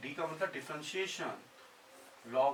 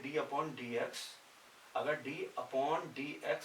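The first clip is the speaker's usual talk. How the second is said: slow dictation; much slower than usual. Shown as a demonstration. Stressing notation dx slowly.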